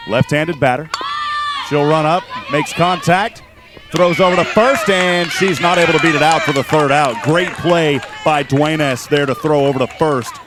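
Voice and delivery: lively softball commentary on an out; excited play-by-play. Real, not acted. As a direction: call the throw to first live, with punch. Live play-by-play of final out.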